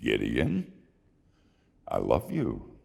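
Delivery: God answers the dancing Gideon, low and gentle; God's voice. Acted. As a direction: speak like a patient, loving God. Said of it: "And God said".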